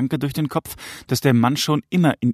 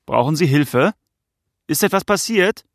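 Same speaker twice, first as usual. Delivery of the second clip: raised, higher voice calling to a stranger; 5 semitones higher, 4 dB louder than usual. Acted. Father to the silent stranger in the garden, calling out. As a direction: call out the question across a distance.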